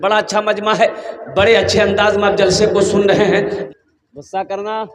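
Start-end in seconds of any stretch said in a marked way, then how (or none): 4.1-4.9 softer, almost under the breath; murmured prompt to the audience; soft question. Real, not acted. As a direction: ask the crowd quietly first. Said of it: "अरे बोलो भाई गुस्सा करना?".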